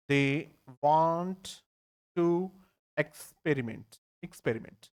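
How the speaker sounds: each word said slowly with pauses. Shown as a demonstration. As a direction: break it into separate words for the class. (एक-एक शब्द रुककर बोलना)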